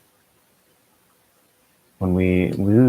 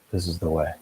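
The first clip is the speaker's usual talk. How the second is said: soft mantra; 6 dB quieter than usual. Shown as a demonstration. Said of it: "And we just keep doing that".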